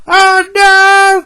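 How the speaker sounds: loud, very high wail of dismay; drawn-out high cry of alarm; James crying out. A narrator's reading. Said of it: "cried James".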